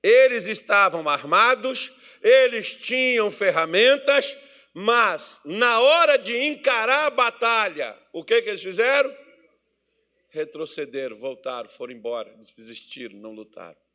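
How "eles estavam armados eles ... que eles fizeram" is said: loud, high, slow sermon delivery; forceful preaching. Real, not acted. Preacher challenging the congregation about the battle, declaiming loudly. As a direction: hammer out each phrase and throw the question to the church.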